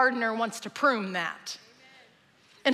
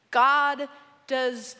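very slow, spaced-out words; slow and deliberate; much slower than usual. Real, not acted. Preacher stating the lesson to church, slow and deliberate. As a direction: give each word its own beat.

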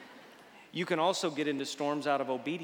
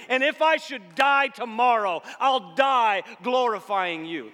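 loud, building preaching, surging higher; building sermon; 8 semitones higher, 9 dB louder than usual. Acted. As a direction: climb toward a full-voiced peak. Preaching at full force.

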